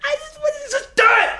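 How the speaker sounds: very high, loud hammed-up demand; frantic yelling. Gunman forcing the clerk, hammed-up screaming order.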